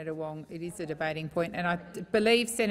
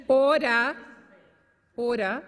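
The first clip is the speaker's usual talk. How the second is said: calling to order; 3 semitones higher, 6 dB louder, slower than usual. Real, not acted. Presiding officer calls for order.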